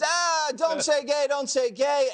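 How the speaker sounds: high, loud imitation of protesters; mock screaming. Host imitates employees yelling at executives, mock screaming. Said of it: "just screaming at executives".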